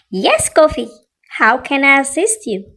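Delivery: ordinary delivery, nothing marked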